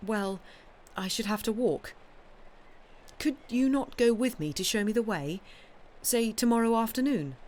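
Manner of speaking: lightly voiced pleading wife; hopeful wife. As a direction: urge her gently to come along. Wife asks Rhoda to come.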